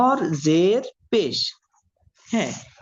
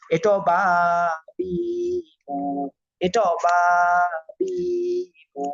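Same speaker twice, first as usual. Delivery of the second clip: বা, বি, বু each held long; drawn-out vowels; 2 semitones higher than usual. Shown as a demonstration. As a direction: stretch বা বি বু, pausing between them. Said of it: "এটা automatic টান হয়ে যাবে".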